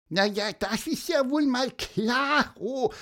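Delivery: very high, squeaky cheep; chirping little bird. Acted. Little bird agreeing to help the mother, cheeping. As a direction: pipe it out like a tiny bird. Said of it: "piepste Pieper".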